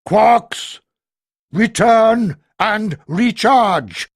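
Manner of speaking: loud, slow, proud order; belligerent command. A narrator's reading. Giant voice recalling the Quarks, loud proud command. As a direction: command them back with satisfaction. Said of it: "belligerent voice commanded".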